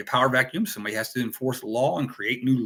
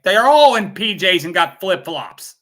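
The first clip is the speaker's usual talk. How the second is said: exasperated; 6 semitones higher, 9 dB louder than usual. Acted. Exasperated about identical crowd.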